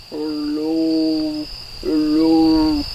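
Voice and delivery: deep, low hello; as low as we can. Shown as a demonstration. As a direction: drop the voice right down to the bottom. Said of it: "Let's say hello as low as we can".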